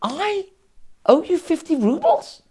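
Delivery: high, rising "I owe you fifty rubles?" in feigned disbelief; mock astonished question. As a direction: play dumb, pitch shooting up on the question. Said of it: "Rabbi looked at him and goes".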